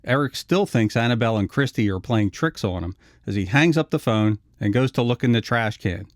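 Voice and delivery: usual speaking voice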